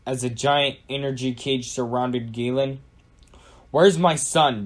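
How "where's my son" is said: loud, raised demand; insistent storybook demand. A narrator's reading. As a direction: demand an answer, firmly and louder. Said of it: "demanded Galen".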